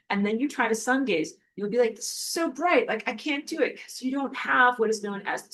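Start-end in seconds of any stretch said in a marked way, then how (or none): 1.9-3.8 high complaining. Pretend. Imagined newcomer squinting at sun.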